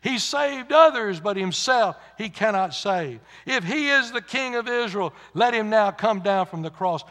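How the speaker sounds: preacher voicing jeering priests, loud and high; imitated scornful mockery, punched loudly; mocking. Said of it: "The chief priest mocking him".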